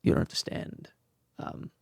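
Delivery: whispering it like the director did; a hushed, discreet word on the side; whispered correction. Retold or imitated. Director pulling him aside with a whispered correction.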